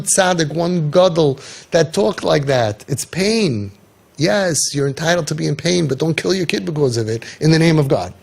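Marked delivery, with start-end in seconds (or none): none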